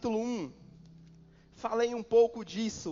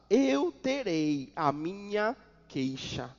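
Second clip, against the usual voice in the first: leitura solene; slower than usual. Acted. Solemn scripture reading, slow.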